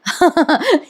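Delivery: short real chuckle breaking out; real laugh. Real, not acted. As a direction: laugh at the joke. Narrator laughs at the jibe. (छोटंसं खरं हसू)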